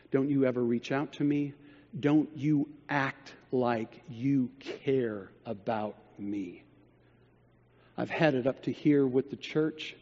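low, quiet and icy, each line a warning; cold quiet threat. Retold or imitated. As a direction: drop the charm and go cold and menacing. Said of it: "he turned it off like a faucet".

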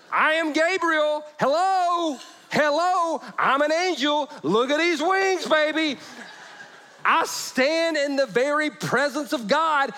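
high, loud, slowed angry angel; angel yelling. Acted. Gabriel scolding Zechariah, loud and high. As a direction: shout it down from heaven, hamming it up. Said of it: "an angel yelling at you".

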